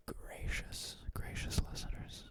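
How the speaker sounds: whispered, very soft; hushed, voiceless whisper; playful whisper. Shown as a demonstration. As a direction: whisper the nickname, savouring it. Playing with the new nickname.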